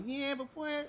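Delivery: high-pitched mock rap opening; mimicked new-school rapper. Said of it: "starts off talking like".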